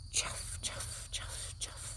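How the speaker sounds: whispered chuff chuff; soft chuff. A narrator's reading. Reading to children, whispered soft footsteps. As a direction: breathe it like quiet shoes. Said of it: "They make a soft sound".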